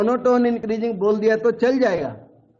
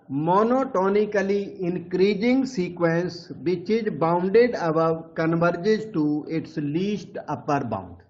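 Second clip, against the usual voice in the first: slow dictation; much slower than usual. Shown as a demonstration. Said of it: "dictating theorem slowly to students".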